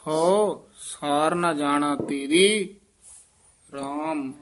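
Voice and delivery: ਤੇਰੀ ... ਰਾਮ with a break before ਰਾਮ; ram set apart. Shown as a demonstration. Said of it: "ਰਾਮ ਪਦ ਜਿੰਨੇ ਹਨ, ਇਹ ਨਖੇਰ ਕੇ ਪੜ੍ਹਨੇ ਹਨ".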